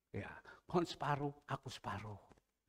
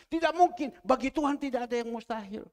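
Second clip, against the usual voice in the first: shouted high declaration of faith; preacher's loud cry; loud proclamation; 8 semitones higher, 10 dB louder than usual. Real, not acted. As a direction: proclaim it with full force.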